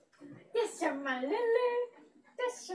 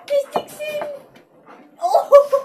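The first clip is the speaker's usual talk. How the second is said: high, loud, crying-laughing squeal; overjoyed to see the dog; excited squeal; 12 semitones higher, 11 dB louder, slower than usual. Real, not acted. Owner squeals with joy over her dog.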